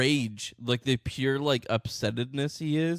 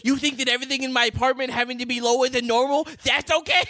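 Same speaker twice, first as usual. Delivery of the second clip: higher-pitched, louder indignant parody; mock ranting short man; 8 semitones higher, 4 dB louder than usual. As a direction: ham up the furious little man's outrage.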